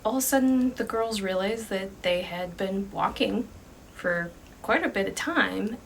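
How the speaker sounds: ordinary delivery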